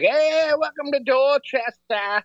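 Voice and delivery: put-on high, drawn-out Boston accent greeting. Parking attendant greeting drivers, thick Boston accent. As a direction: sing out a stretched Boston welcome. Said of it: "Boston accent, like, just".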